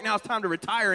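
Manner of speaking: ordinary delivery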